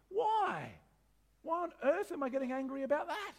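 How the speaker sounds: incredulous. Real, not acted. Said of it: "What a dumb thing to get angry about".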